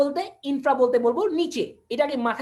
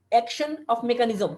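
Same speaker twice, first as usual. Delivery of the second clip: slow dictation; much slower than usual. Shown as a demonstration. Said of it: "teacher spells out term slowly".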